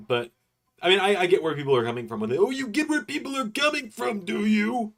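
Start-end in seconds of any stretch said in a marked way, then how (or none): 2.4-4.9 high, teasing sarcastic repeat; mocking. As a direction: throw his words back mockingly. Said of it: "mocking his own words back".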